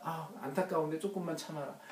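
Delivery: a sorrowful father soothing his son; a sigh, then tender quiet reassurance; soft pitying comfort. Acted. God's comfort fading soft.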